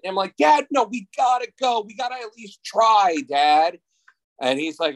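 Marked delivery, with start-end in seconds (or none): 0.4-3.8 put-on high, eager kid begging his dad; excited kid pleading. Boy begs dad to rent the movie, high and eager. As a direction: plead like a ten-year-old who can't wait.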